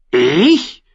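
hoch gekrächztes Nachäffen; krächzender Rabe. A narrator's reading. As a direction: ein Rabe plappert das Wort nach. Rabe äfft Sam nach. (der Rabe äfft Sam hoch krächzend nach)

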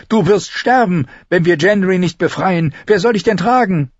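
high, heated character voice; indignant high-pitched rebuke; angry. A narrator's reading. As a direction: argue back crossly, pitched high. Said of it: "wütend entgegen".

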